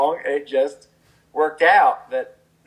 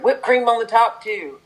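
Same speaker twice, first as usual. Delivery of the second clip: excited addition; 11 semitones higher, 5 dB louder than usual. Retold or imitated. Adding a line while writing.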